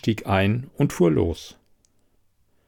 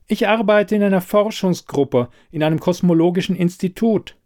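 higher, steady self-assertion; composed, determined, raised pitch; firm voice; 8 semitones higher than usual. A narrator's reading. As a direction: state your job firmly. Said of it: "Dann mit fester Stimme".

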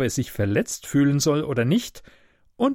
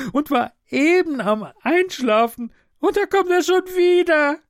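high, loud wailing plea; pleading invalid; 11 semitones higher, 4 dB louder than usual. Acted. Woken sick passenger begging the conductor, high wailing. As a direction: beg desperately to be left alone.

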